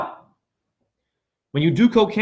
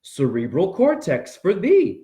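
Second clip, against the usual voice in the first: ordinary delivery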